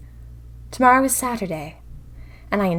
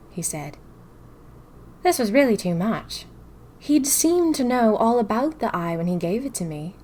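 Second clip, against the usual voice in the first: ordinary delivery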